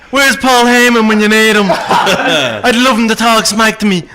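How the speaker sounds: Irish brogue, laughing brag; cocky Irish accent, rolling with laughter; Conor McGregor impression. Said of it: "the Connor one".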